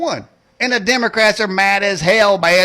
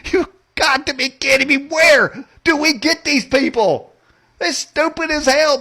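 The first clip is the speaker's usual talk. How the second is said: exasperated disbelief; 7 semitones higher than usual. Real, not acted.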